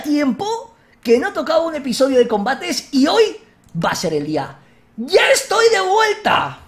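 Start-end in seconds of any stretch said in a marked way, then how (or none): 5.0-6.6 drawn-out, high, loud homecoming shout; slow triumphant yell; triumphant call. Real, not acted. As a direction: announce your return like a hero. Returns triumphantly, calls out.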